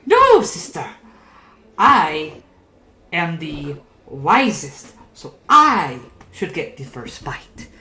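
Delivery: quarrelling puppet sister, stretching each claim; 第二个丑木偶. Acted. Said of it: "second ugly puppet goes".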